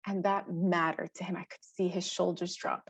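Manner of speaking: ordinary talk, nothing marked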